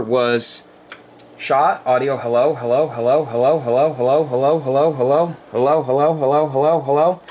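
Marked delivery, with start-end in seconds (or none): 1.4-7.2 steady test count over the air; radio mic check. Real, not acted. Ham operator testing his amp, radio check calls.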